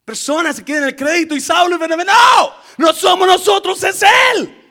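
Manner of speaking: shouted high preaching; preaching at full force. Acted. Preacher shouting to the congregation about credit. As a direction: cry it out to the church.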